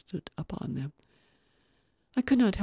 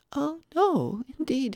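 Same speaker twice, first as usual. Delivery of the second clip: put-on much higher childlike reply; polite little boy; 10 semitones higher, slower than usual. Little boy reassuring the guest, politely childlike. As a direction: answer as a well-mannered small child.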